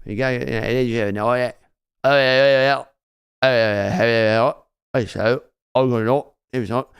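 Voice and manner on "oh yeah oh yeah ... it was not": Australian accent. Shown as a demonstration. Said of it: "very easy to get a British one".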